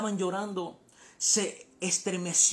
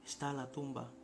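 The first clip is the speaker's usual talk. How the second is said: very soft, low, grave telling; hushed and solemn; 8 semitones lower, 13 dB quieter than usual. Real, not acted. Preacher telling how Jesus wept at the tomb, hushed and solemn. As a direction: drop right down into a reverent hush.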